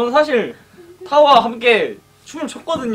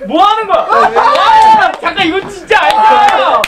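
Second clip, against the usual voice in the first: shrieking jackpot winner; 9 semitones higher, 6 dB louder, slower than usual. Real, not acted. Watching member write, shouting.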